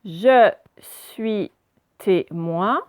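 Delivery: "Je suis témoin" broken into separate syllables; slow teacher's decoding of "Je suis témoin"; syllable-by-syllable reading. Shown as a demonstration. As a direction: read "Je suis témoin" haltingly, a pause after each syllable. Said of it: "on lit d'abord la première partie".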